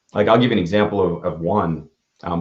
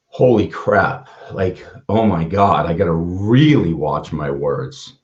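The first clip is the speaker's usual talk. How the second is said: slow, stretched words of dawning shock; stunned realization; slower than usual. Retold or imitated. Speaker recalls hearing the girl chose to live, stunned. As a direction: let the weight land, drawing out the key words. Reliving his shock at the news.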